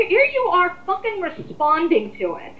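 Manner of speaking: rising to a fired-up peak at the end of a rant; heated outburst. Real, not acted. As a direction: pitch jumps high and loud in a heated rant. Heated rant against the commenter.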